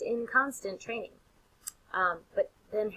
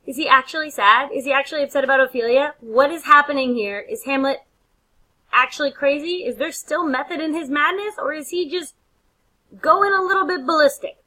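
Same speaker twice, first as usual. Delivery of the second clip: worked up; 7 semitones higher, 8 dB louder than usual. Real, not acted. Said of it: "I'm getting all worked up".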